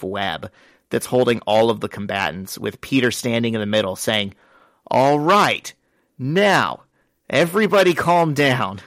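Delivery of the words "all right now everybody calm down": raised, higher voice, each phrase set apart; acted exasperated command. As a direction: call a brawling room to order, pausing between commands. Hero quieting a room of fighters.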